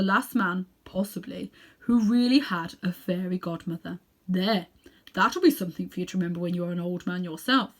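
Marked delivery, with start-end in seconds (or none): none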